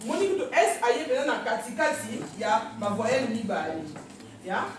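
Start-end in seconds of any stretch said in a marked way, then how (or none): none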